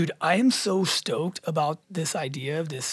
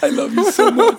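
loud, high gush of love; gushing shout to a friend; excited affection; 11 semitones higher, 11 dB louder than usual. Real, not acted. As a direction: call it out high and loud, full of delight. Calling affection to Sandy nearby.

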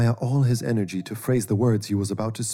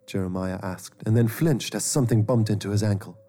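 usual speaking voice

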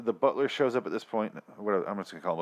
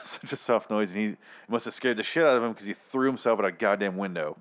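usual speaking voice

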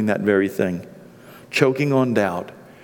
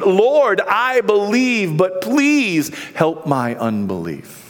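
exclaimed plea; 7 semitones higher, slower than usual. Acted. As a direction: cry out to the Lord, then plead slowly. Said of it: "the father exclaimed".

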